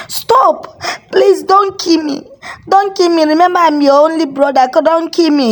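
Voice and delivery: high panicked begging; terrified pleading. A narrator's reading. Chukwunano begging the ghost not to kill him, high panic. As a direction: plead high and fast for your life. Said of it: "he continued shouting".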